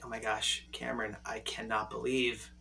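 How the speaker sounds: quoting her softly, low and hushed; imitating embarrassed date. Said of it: "she kept saying, she's like".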